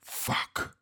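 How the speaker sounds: breathy under-the-breath curse; muttered curse. A narrator's reading. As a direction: swear to himself quietly. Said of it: "Casper muttered".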